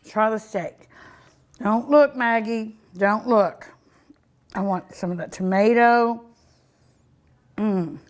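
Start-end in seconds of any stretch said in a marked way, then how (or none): none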